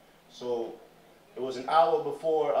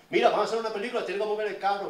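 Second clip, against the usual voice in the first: high, loud pushy crew member in Spanish; loud bossy crew demand; bossy film crew; 8 semitones higher, 6 dB louder, much faster than usual. Retold or imitated. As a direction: order them off the block like a film boss. Film crew ordering locals in Spanish.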